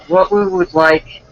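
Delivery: put-on girly date voice; girl's voice. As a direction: raise the pitch to play the girl. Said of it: "then I'll do the girl's voice".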